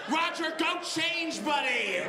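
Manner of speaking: shouting at a coworker to go change, mock yell; mock office shout. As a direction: yell it at the coworker, half laughing.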